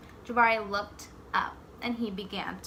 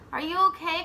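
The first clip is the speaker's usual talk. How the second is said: raised, higher call up the ladder; 7 semitones higher, 4 dB louder, faster than usual. Acted. Dad calling up the ladder to his son, raised voice. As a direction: call up to him, concerned.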